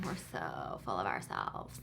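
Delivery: sarcastic actor voice. Retold or imitated. Self-mocking actor aside.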